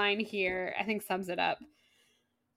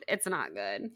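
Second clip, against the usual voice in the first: sad murmur; 11 semitones lower, 8 dB quieter than usual. Real, not acted. Host lamenting Nate's situation, low sad murmur. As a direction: lower and softer, NOT leaned on.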